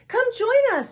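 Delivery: high, loud, eager invite; excited call. A narrator's reading. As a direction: call out cheerfully to a friend. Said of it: "said Millie".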